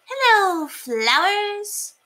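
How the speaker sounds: cheerful lanky mouse; tall mouse. A narrator's reading. Very Tall Mouse greeting friend, high and slow.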